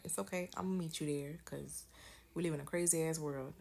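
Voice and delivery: ordinary talk, nothing marked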